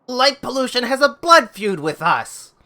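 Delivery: acted high, loud protest; angry accusation. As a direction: throw the accusation at your rivals. Character in an acted quarrel.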